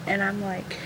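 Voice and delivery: usual speaking voice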